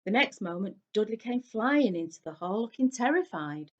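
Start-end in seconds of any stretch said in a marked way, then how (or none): none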